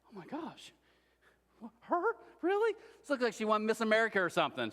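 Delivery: daughter gushing, jumping way up on Really; excited teen squealing in disbelief. Retold or imitated. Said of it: "she's like".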